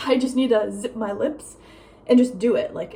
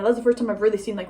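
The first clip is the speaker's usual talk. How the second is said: usual speaking voice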